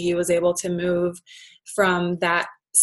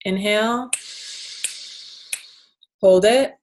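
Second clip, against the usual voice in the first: guided breathing. Shown as a demonstration. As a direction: lead the breath calmly, with long pauses. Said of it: "let's actually just do that right now".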